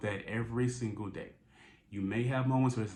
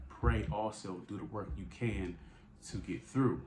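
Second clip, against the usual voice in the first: ordinary talk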